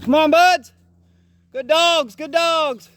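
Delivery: high, loud praise call. Real, not acted. Handler praises his dogs from afar, loud and high. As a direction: shout encouragement to the dogs ahead. Calling dogs through the woods.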